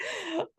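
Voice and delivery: high surprised gasp; a sudden high gasp of surprise; sharp gasp. Retold or imitated. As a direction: gasp in delighted shock. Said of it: "And I'm like".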